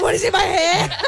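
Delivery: high, laughing imitation of alarm; mock startled reaction. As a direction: act out a corpse suddenly startled. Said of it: "He's like".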